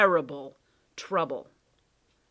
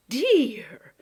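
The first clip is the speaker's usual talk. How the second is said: alarmed cry; 8 semitones higher, 10 dB louder than usual. Acted. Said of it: "The cat blinked in alarm".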